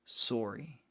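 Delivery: softly said sorry with a rounded "oh", "sore-ee"; Canadian-style sorry. Shown as a demonstration. Streamer showing what most Canadians say, sorry as "sore-ee". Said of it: "most Canadians will say".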